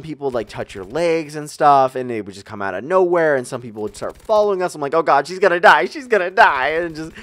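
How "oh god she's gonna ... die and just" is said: higher, louder hammed-up fear; joking that the girl behind will die first, in mock panic. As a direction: play it like a scared kid in the dark. Mock panic in haunted house.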